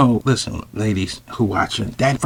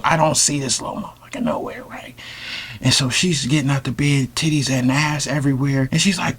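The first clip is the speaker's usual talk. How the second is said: normal talking voice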